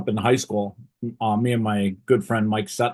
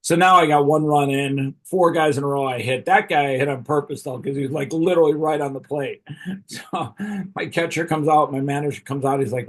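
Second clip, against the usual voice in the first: ordinary delivery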